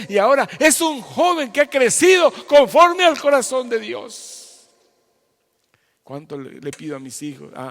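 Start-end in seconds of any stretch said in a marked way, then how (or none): 0.1-4.7 booming preaching. Real, not acted. Pastor preaching to listeners.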